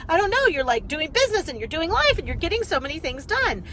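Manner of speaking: high, bubbly admiration; the fan listing what she does, high and admiring. Retold or imitated. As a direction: gush about her busy life. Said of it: "she's like".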